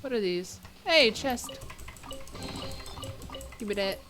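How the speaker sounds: ordinary talk, nothing marked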